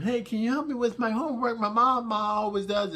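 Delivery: high, lightly voiced child; little girl voice. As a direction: ask it like a small girl. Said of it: "the little girl asking him".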